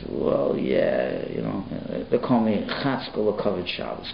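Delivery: bashful mumble. Retold or imitated. Bashful porter admits nickname.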